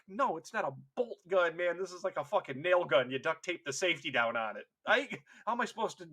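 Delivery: mock indignant protest. Recruit given a nail gun for battle, indignant. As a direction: object in comic disbelief.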